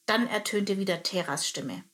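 ordinary delivery, nothing marked

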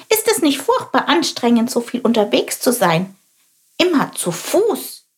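high, curious little bird voice; little sparrow voice. Acted. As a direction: chirp it like a small inquisitive sparrow. Little sparrow Chilp asks. (hohe, neugierige Spatzenstimme)

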